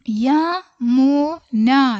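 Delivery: Yamuna stretched slowly, syllable by syllable; very slow drill. Shown as a demonstration. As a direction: draw out Yamuna for beginners. Teacher very slow model word. (Yamuna വളരെ പതുക്കെ നീട്ടി)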